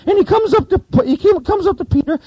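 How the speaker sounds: squeaky voice. Retold or imitated. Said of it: "squeaky voice like this".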